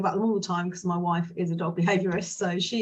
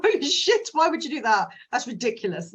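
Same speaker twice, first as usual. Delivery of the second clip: high, loud shocked gasp of disbelief; appalled outburst; 4 semitones higher, 3 dB louder than usual. Acted. Cautious cat person horrified by random takeaway, shocked outcry. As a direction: play the horrified cautious one recoiling.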